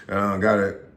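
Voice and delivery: ordinary delivery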